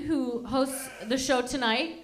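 usual speaking voice

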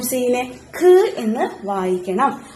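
ഖ് stressed, said slowly; teaching stress. Shown as a demonstration. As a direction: lean on ഖ് deliberately. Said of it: "എന്ത് വായിക്കണം". (ഖ് ഊന്നി പതുക്കെ പറയുക)

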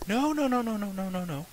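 high, rapid panicked no's; frantic denial. Acted. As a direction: deny it in a panic. Tim frantically denies drinking.

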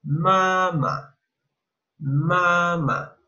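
"mamá" said in Mandarin tones, falling; Chinese word. Shown as a demonstration. Teacher showing the Chinese word for mom.

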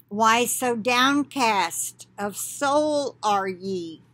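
measured, declaiming scripture voice; prophetic reading. Acted. Reading the prophecy to online brethren, solemn declaiming. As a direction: speak as the Lord addressing his flock.

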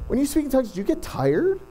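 high, curious imitation of a Newfie; mock Newfie questioner, high voice; Newfie accent. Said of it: "a bit of a Newfie accent".

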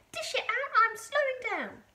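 high, loud, urgent granny demand; impatient yell. A narrator's reading. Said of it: "she yelled".

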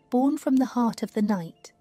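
plain reading voice

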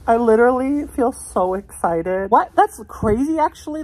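high, thrilled disbelief; giddy with real excitement; excited. Real, not acted. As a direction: gush with amazed excitement. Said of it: "I feel so excited".

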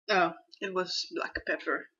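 talking with a burning mouth; strained, speaking past the heat. Real, not acted. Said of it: "My mouth is burning".

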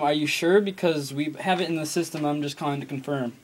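ordinary talk

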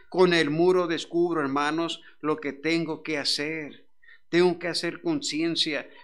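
loud fervent preaching. Acted. Pastor proclaiming to his church, loud and fervent. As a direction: proclaim it to the congregation.

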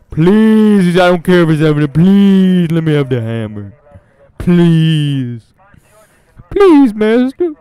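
high, loud pleading whine; hammed-up plea, high and loud, stretched on the last; mock begging. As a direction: beg like a servant for the hammer.

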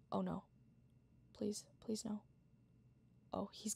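soft anxious whisper; whispering while hiding. Real, not acted. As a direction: beg under your breath. Hiding, pleading quietly.

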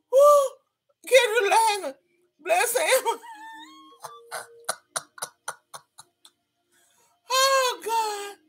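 high, laugh-cracked voice. Real, not acted. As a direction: squeak out between laughs. Laughing fit.

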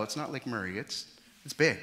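ordinary talk, nothing marked

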